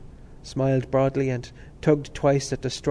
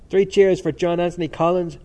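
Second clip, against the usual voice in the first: loud, high rallying cry; calling for cheers; 5 semitones higher, 8 dB louder than usual. A narrator's reading. Temple rousing the students for Collins, cheering loudly. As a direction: raise a cheer from the crowd.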